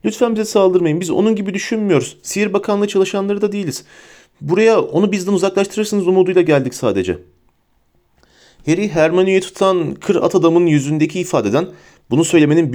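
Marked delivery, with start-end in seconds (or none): 0.0-7.2 high, urgent pleading; mildly breathless plea for mercy; out of breath. A narrator's reading. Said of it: "dedi Hermione nefes nefese". (nefes nefese, yalvararak)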